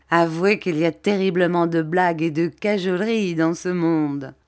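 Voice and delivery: ordinary delivery, nothing marked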